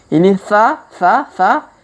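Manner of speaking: sa with the tongue between the teeth, a clear lisped th; teacher's demo of sa. Shown as a demonstration. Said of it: "lidah berada di antara gigi".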